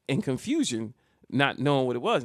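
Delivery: ordinary talk, nothing marked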